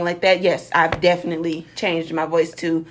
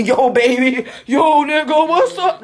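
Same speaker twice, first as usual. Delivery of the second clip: loud, rising, laughing slang hail; hammed-up buddy greeting through a laugh; mock street greeting; 5 semitones higher, 4 dB louder, slower than usual. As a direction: call out over-casually, climbing higher. Mock street greeting in business meeting.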